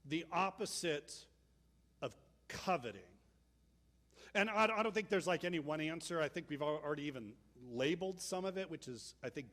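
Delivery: ordinary talk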